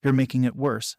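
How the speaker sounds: ordinary delivery, nothing marked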